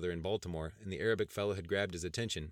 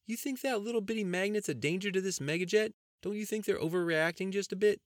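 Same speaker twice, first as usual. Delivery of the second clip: goofy cousin Andy; 13 semitones higher, 6 dB louder than usual. Acted. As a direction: play the joking country cousin up high. Andy's character voice.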